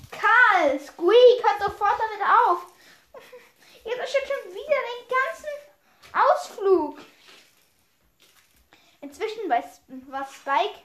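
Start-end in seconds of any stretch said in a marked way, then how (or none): 0.1-7.0 loud high telling off; scolding shout. Acted. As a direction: yell at the squabbling pair. Adult scolding fighting kids.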